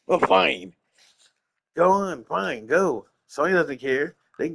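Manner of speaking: loud, huffy mock dismissal; nasty attitude. Streamer dismissing a fan who is leaving, nasty attitude. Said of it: "he just gets a really nasty attitude".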